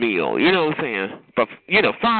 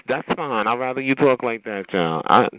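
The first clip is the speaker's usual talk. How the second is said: ordinary talk, nothing marked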